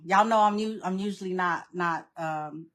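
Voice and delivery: ordinary delivery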